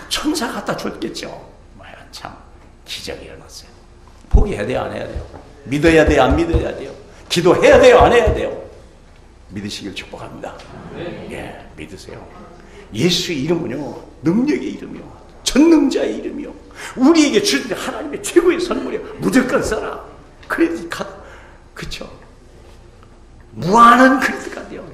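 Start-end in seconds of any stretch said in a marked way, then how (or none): 4.3-8.7 rising to loud, high questions; building preaching. Acted. Pastor challenging the congregation, building to loud and high. Escalating call and response. 12.9-24.9 high, loud proclamation with laughter; climactic preaching. Acted. Pastor exalting the name of Jesus to the church, high and loud.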